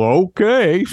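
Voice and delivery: high, put-on horse reply; dopey horse voice. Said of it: "What did the horse say?".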